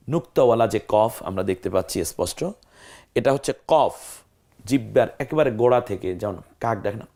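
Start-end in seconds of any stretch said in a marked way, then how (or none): none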